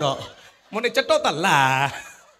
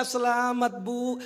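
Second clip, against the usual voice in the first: midwife voice; 6 dB quieter, slower than usual. Retold or imitated. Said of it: "Kata bidannya".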